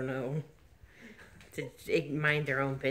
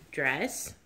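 ordinary talk, nothing marked

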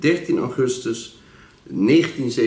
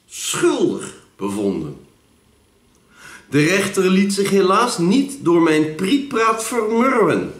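theatrical poem reading with higher, swooping pitch; poem recital; 7 semitones higher than usual. Acted. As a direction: recite it like a mock-serious courtroom tale.

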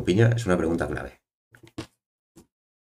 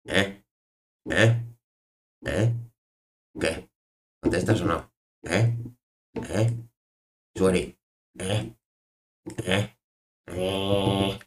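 short questioning "¿Eh?", over and over; insistent pestering; 2 semitones higher, much slower than usual. Pretend. Streamer nagging a chatter to answer, insistent. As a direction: keep prodding for a reply, pitch tipping up.

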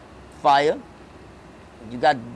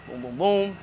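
usual speaking voice

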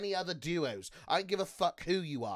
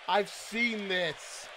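stretched out at usual volume and pitch; slow statement; much slower than usual. Real, not acted. Streamer answering whether he's seen it, slow. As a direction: say it slowly, drawing out each word. Repeats answer to viewer's question.

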